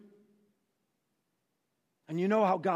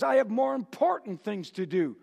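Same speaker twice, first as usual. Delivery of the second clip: David resolute; 6 semitones higher, 3 dB louder, slower than usual. Acted. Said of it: "and says".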